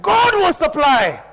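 "God" drawn out high and loud; preacher emphasis. Shown as a demonstration. Preacher answering his own call, loud slow stress on "God". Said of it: "put emphasis on that God part".

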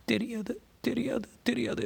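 high-pitched repeated denial, no real volume; a narrator's contained cry in a woman's high voice. A narrator's reading. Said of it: "என்று கத்தினாள்".

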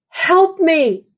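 high pleading yell; yelled cry. Retold or imitated. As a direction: cry out for rescue, retelling a waking scream. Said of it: "and I yelled out".

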